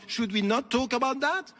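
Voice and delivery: normal talking voice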